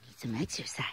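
soft unvoiced aside; whisper. Real, not acted. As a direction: whisper it under your breath. Whispered aside about the dog.

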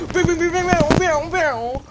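loud high mock laser zaps; laser sounds. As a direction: fire off playful laser noises. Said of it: "I can hear your fucking lasers".

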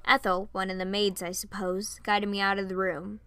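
usual speaking voice

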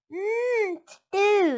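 high, loud longing mmm; the little boy longing for stew; dreamy sigh. Acted. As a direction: sigh over the stew hungrily. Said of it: "he sighed".

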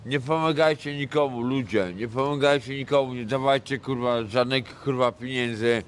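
ordinary talk

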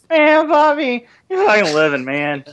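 put-on high, loud drunk uncle; imitating the old drunk. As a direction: play the rowdy drunk at the reunion. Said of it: "you just sounded like the old drunk at the family reunion".

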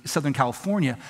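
ordinary talk

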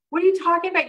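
loud, high disbelief at what he just heard; incredulous outburst. Retold or imitated. Telling his company president he can't drive, shocked and loud. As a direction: blurt it out, shocked. Stunned reply to boss's news.

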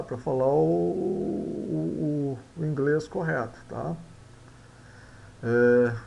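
ordinary delivery

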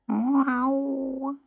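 high, drawn-out mewed word; kitten meow. A narrator's reading. Kitten answering the farmer's wife, long high meow.

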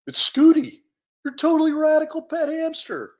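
high, enthusiastic bear; proud reveal. Acted. Bear telling Louis who he is, excited reveal. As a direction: announce yourself brightly and loudly.

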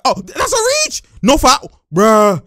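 high, loud outburst; indignant courtside holler; shouted protest. Real, not acted. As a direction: yell at the referee in disbelief. Protesting a call live.

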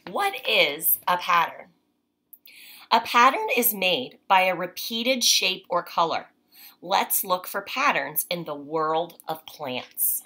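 clear, measured read-aloud voice; reading to children. Acted. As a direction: read the page to a child, unhurried. Reads picture book aloud.

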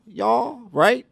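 a playful Southern y'all; Southern drawl. Shown as a demonstration. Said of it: "with a Southern drawl".

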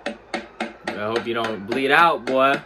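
ordinary talk, nothing marked

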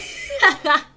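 bursting laugh. Real, not acted. Streamer cracking up after losing, bursting laugh.

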